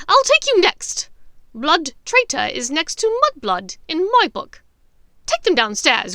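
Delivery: high, loud menacing witch; vicious threat. Acted. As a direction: savour every threat. Bellatrix threatening.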